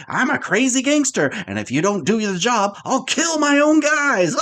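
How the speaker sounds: mock a cartoon gangster threat, ending in laughter; mock crazy gangster. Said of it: "He's just like".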